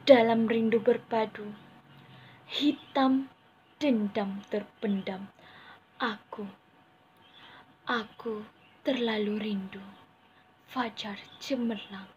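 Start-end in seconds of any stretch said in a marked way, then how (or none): none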